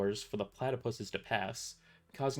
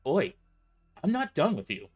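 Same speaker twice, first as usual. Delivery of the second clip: calling back; 5 semitones higher, 7 dB louder than usual. A narrator's reading.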